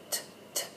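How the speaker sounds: "t" as a pure breathy unvoiced t, no vowel; whispered t sound. Shown as a demonstration. Teacher showing the t sound to parents, whispered. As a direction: breathe out a crisp "t" with no uh after. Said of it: "So that's a t".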